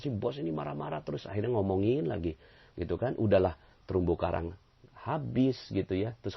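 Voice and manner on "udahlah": normal talking voice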